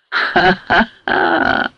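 wild laughter. A narrator's reading. Delirious patient facing imagined foes, wild laughter. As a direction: laugh madly, drawn out. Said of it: "разразился диким хохотом".

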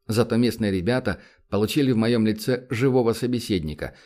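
ordinary delivery

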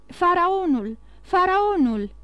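the port crowd hailing the ship, cheering. A narrator's reading. Call out the ship's name together. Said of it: "strigau toți".

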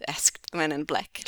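ordinary delivery

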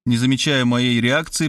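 ordinary delivery, nothing marked

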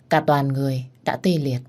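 ordinary delivery, nothing marked

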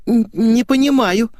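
put-on high, slow, hesitant girlish denial; faltering little voice. A narrator's reading. Girl feigns confusion to her friend, faltering high voice. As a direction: stumble into it in a small high voice. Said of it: "зашептала Светик".

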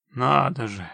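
mock-distressed, understated sigh; rueful sigh. A narrator's reading. Witcher hears of the trapped family, rueful sigh. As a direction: let it fall like a sorry sigh. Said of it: "сокрушённо вздохнул Геральт".

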